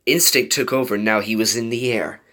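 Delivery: plain reading voice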